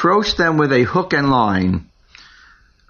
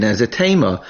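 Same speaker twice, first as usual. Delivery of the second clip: ordinary talk, nothing marked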